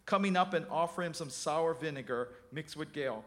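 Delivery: ordinary delivery, nothing marked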